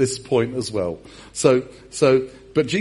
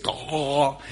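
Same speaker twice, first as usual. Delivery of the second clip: mock half-drowned gasp; choking gasp. Pete hauled into the boat, mock choking gasp. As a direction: gasp hoarsely as if dragged from water.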